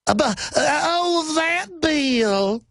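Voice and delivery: very high, slow, swooning Southern belle imitation; mock Scarlett O'Hara. Host mocking a congressman shown the bill, hammed-up Southern belle. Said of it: "He sounded like Scarlett O'Hara".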